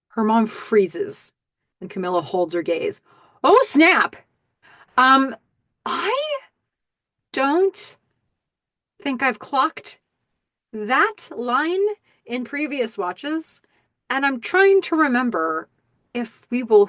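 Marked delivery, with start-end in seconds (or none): 3.4-11.9 excited. Real, not acted. Reacting to a shocking line.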